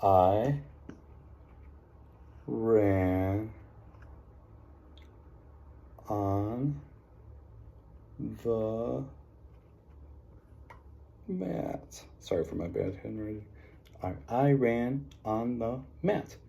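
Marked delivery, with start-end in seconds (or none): 0.0-12.0 write it out one word at a time; dictating word by word. Shown as a demonstration. Teacher to class, slow word-by-word dictation. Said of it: "I saw". 14.0-16.4 "I ran on the mat" read slowly as one phrase; slow read-back. Shown as a demonstration. Teacher to class, reading the sentence back slowly. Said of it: "I saw".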